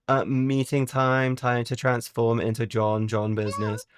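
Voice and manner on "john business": usual speaking voice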